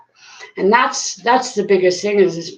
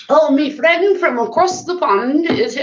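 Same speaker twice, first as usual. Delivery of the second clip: higher, playful attempt at a British accent; badly faked British accent, pitched up; botched British accent; 8 semitones higher than usual. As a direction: have a go at British and jack it up. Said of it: "I totally jacked up the accent".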